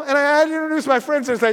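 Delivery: ordinary delivery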